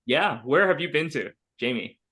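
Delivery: ordinary talk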